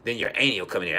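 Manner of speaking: usual speaking voice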